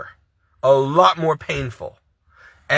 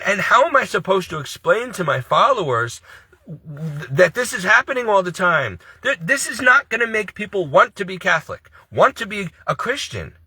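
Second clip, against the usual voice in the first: ordinary delivery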